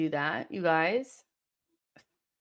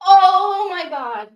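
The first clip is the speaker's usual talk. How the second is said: exasperated groan; 9 semitones higher, 12 dB louder, slower than usual. Real, not acted. Exasperated at the match.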